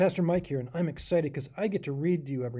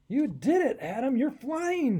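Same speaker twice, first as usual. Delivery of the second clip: higher, louder cheering shout; excited shout; 8 semitones higher, 4 dB louder than usual. Acted. Sparrow cheering Adam as the glider soars, shouting. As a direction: cheer him on as he flies. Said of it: "Sam shouted".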